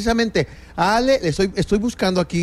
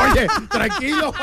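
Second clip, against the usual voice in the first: laughing it out, higher; 1 laughing tease; 12 semitones higher than usual. Real, not acted. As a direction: tease him through a laugh. Se burla riendo de su amigo. (burla entre risas a un amigo intenso)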